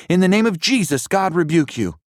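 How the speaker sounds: high, loud commanding rebuke; bold shout. A narrator's reading. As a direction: command it in Jesus' name. Rebuking the witch.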